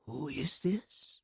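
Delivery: hushed Russian whisper, slow; a barely voiced old woman answering the phone; soft whisper. A narrator's reading. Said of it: "It was a whisper in a way".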